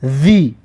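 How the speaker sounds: ذی with a voiced th on the tongue tip; tongue between the teeth to voice ذی. Shown as a demonstration.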